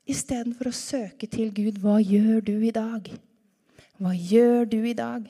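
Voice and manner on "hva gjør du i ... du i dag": loud prayer cry. Acted. Praying loudly to God.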